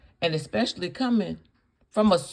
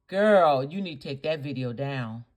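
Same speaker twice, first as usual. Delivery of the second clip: normal talking voice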